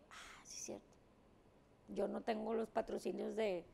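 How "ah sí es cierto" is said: whispered to herself; whispered realization. Retold or imitated. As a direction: breathe it as a sudden realization. Realizing, retold inner thought. (susurro de darse cuenta)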